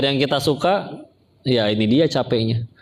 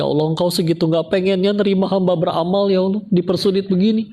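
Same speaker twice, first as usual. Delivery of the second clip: higher-pitched lament to God; imitated tearful plea; 6 semitones higher than usual. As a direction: act out a mild, pleading wail. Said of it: "nangis dia". (meniru ratapan sambil menangis)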